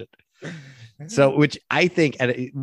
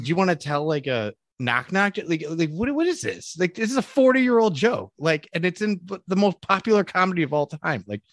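higher, incredulous rant; mock exasperation; 7 semitones higher than usual. Retelling offence at a forty-year-old joke, incredulous and exasperated. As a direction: rise into a baffled, half-laughing protest.

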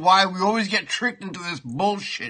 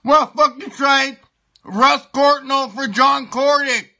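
higher, louder, slowed outrage at the trade; angry rant; 6 semitones higher, 5 dB louder, slower than usual. Real, not acted. Fan furious about the Courtnall trade, loud slow outrage. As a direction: spit out the names in disbelief.

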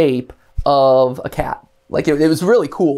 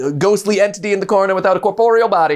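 auctioneer; 3 semitones higher, slower than usual. Shown as a demonstration. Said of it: "imitate an auctioneer".

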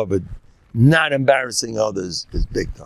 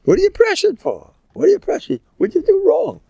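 incredulous; 4 dB louder than usual. Acted. Rabbi mocking the worry, incredulous.

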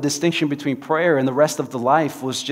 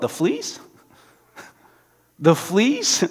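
high, rising disbelief with a laugh; can't believe it; incredulous; 5 semitones higher, much slower than usual. Acted. Corrie hearing the thanks for fleas, incredulous.

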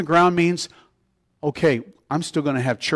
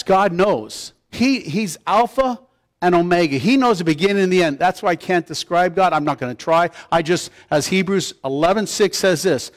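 ordinary talk

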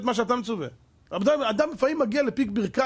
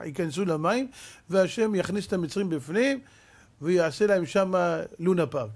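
normal talking voice

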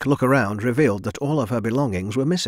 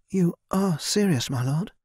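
put-on young girl in disbelief; 8 semitones higher than usual. A narrator's reading. Narrator voices Mina, disbelieving.

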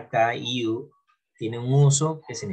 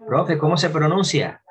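higher, louder imitation of a pupil asking; mock a student calling out to the teacher; lightly voiced questioning pupil; student's question; 5 semitones higher, 5 dB louder than usual.

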